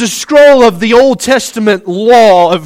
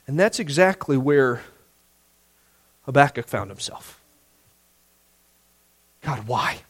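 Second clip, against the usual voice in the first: hushed close; 9 semitones lower, 13 dB quieter than usual. Real, not acted.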